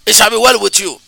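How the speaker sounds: usual speaking voice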